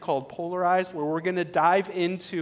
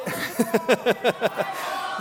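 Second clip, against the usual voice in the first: a short real laugh; 5 semitones higher than usual. Real, not acted. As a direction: laugh at the crowd's answer. Pastor laughs at the crowd's response.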